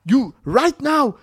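high, loud demand in a put-on African accent; mock threatening. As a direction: lean on him for money, hammed up. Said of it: "threatening him".

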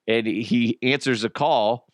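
ordinary talk

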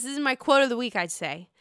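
normal talking voice

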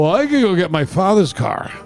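usual speaking voice

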